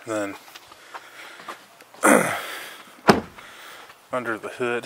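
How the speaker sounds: ordinary delivery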